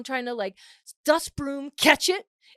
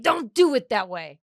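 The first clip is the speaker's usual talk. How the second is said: louder, rising bossy dad lecture; mock boomer scolding; 4 semitones higher, 8 dB louder than usual. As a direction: scold like a know-it-all boomer. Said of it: "hands on the hips and he's like".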